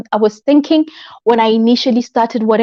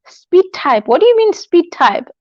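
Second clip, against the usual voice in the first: playfully baffled, a bit higher; mock puzzled; 6 semitones higher than usual. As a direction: feign confusion at the comment.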